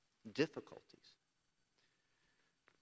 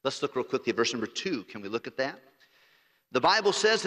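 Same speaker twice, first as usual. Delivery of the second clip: usual speaking voice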